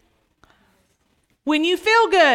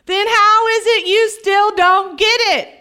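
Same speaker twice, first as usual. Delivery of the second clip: higher, emphatic frustration; exasperated; 4 semitones higher than usual. Acted. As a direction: demand it, at your wits' end. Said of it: "That's how Jesus said it".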